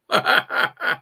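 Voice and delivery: hearty laugh at own voice; self-amused burst of laughter; real laugh. Real, not acted. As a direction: crack up at your own joke. Laughs at own joke about singing.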